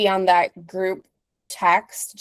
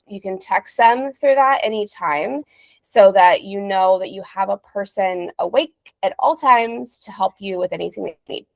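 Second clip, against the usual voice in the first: ordinary talk, nothing marked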